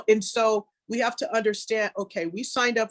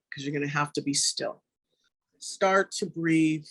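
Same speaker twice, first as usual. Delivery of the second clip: calm, unhurried meditation guide voice; guided meditation. Acted. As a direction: ease the listeners into stillness, gently.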